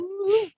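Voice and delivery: high-pitched cheering "woo"; celebratory whoop. Real, not acted. Game table reacts to a decent nature roll, whooping.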